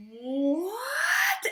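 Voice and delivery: high, crying disbelief; anguished what. Retold or imitated. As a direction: break at the betrayal. Snape about to kill Dumbledore.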